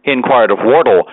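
ordinary delivery

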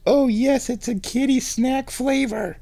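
very high kitty-cooing voice. Real, not acted. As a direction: gush it high to a cat. Answering for or to cat.

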